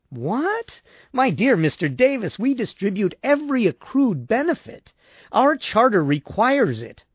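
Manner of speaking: high, incredulous protest; affronted salesman. A narrator's reading. Salesman defends company to doubting client, affronted. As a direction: pitch climbs in pompous indignation. Salesman shocked by customer's suggestion.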